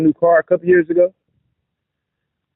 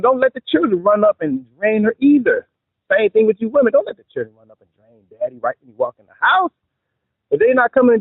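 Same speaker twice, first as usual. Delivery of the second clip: ordinary delivery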